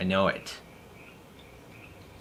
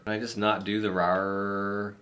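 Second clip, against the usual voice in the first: normal talking voice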